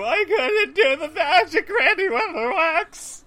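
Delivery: put-on loud high wailing child; bawling little girl, slowly; child crying.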